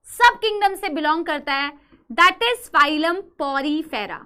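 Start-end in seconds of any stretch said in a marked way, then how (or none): 2.1-4.2 Porifera said slowly, syllable by syllable; teacher slowly modelling "phylum Porifera"; slow model pronunciation. Shown as a demonstration. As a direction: stretch out "Porifera" for students to copy. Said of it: "इसको बोलेंगे".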